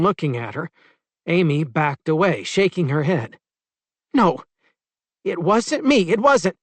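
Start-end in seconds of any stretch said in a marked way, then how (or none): 4.1-6.6 high, loud frightened denial; panicked denial. A narrator's reading. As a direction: deny it, rising panic. Amy denies, backing away.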